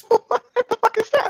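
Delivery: high squeal of laughing disbelief. Real, not acted. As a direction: crack up while asking it. Same line repeated laughing.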